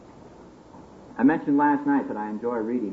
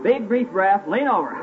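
booming motivational speech; 8 semitones higher than usual. Acted. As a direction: rouse the crowd to their feet. Leading crowd laughing exercise.

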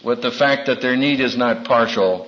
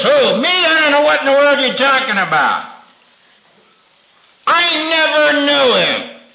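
Peter's denial; 10 semitones higher than usual. Retold or imitated. Peter denies knowing Jesus.

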